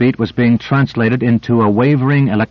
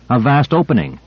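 usual speaking voice

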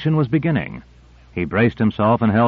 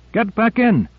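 higher urgent call; urgent cry; 11 semitones higher than usual. A narrator's reading. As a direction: order him back in, pitched high. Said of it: "cried Owens".

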